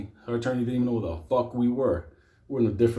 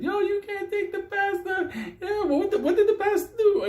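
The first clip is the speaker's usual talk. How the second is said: outraged church lady. Retold or imitated. Congregation member shouts at cops taking the pastor, high. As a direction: protest like a churchgoer blocking the cops.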